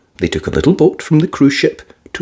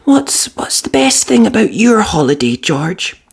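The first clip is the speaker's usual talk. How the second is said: higher motherly voice; Mummy Pig voice; 9 semitones higher than usual. Acted. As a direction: ask like a caring mum. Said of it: "asked Mummy Pig".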